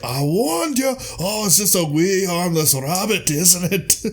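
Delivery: high, mocking I-told-you-so voice; smug enchanter. Tim mocking Arthur after the rabbit attack, high gloating voice. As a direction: taunt the knights like a gloating wizard. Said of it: "Tim's just like".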